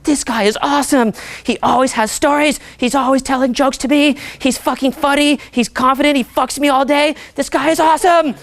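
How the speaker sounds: high-pitched, gushing fangirl impression; gushing girl. A girl who likes his intensity raving about him, high and gushing. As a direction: rave about him as an adoring girlfriend.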